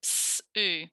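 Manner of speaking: "Sue" split into a long "s", then "oo"; "Sue" sounded out in two parts; consonant-vowel demo. Shown as a demonstration. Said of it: "That's a C V, isn't it?".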